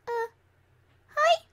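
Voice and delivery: high nervous squeak; squeaky. Acted. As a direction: squeak it out shyly. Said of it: "said with a squeak".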